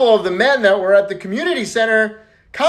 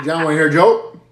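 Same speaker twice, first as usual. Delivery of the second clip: ordinary talk, nothing marked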